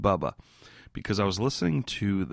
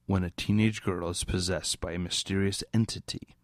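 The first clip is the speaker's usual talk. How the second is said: ordinary delivery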